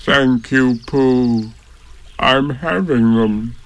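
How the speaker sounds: slow, lightly voiced gloomy donkey; flat donkey gloom, unimpressed; gloomily. A narrator's reading. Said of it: "said Eeyore gloomily".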